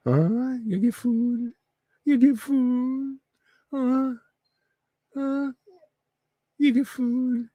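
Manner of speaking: very high, drawn-out pet-talk voice; high, stretched words to excite a dog; teasing dog talk. Real, not acted. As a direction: wind the dog up in a high singsong.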